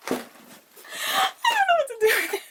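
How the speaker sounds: high, squeaky words squeezed through laughter; helpless laughter. Real, not acted. Unboxer stumped by a supplement, laughing helplessly. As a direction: crack up while trying to speak.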